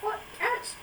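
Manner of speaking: high squeaky retort; mock retort. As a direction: squeal it in joking outrage. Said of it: "I'm like".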